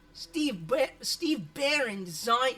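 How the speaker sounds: hammed-up Aussie reading; Aussie accent. Streamer finding the accent while reading, put-on Australian accent. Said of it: "That's a little better".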